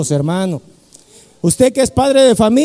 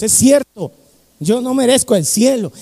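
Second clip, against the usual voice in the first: ordinary talk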